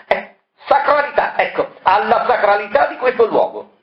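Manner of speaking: high, solemn outrage; pompous indignation. Acted. Dr Moroni scolding a colleague about the sacred crypt, high indignation. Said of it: "annaspò alla ricerca della parola adatta".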